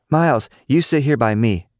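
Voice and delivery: put-on high, bossy little-girl command. A narrator's reading. Cicely bossing Miles to sit, high and commanding. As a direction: order him into the chair. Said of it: "Cicely commanded".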